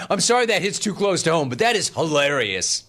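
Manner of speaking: ordinary talk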